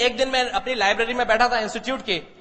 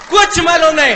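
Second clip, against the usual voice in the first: loud, punching कुछ; loud admission of knowing nothing; emphatic shout; 6 semitones higher, 11 dB louder than usual. Real, not acted.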